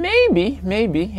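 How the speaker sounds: very high, loud, singsong "maybe"; high-pitched teasing hedge; playful maybe. Real, not acted. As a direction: squeak out a coy, noncommittal "maybe". Playful answer to personal question.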